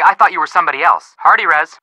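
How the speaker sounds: ordinary delivery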